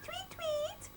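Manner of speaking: very high, soft tweet; bird tweet. A narrator's reading. Birds at dawn, very high soft tweets. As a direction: chirp it like a bird. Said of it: "went the birds".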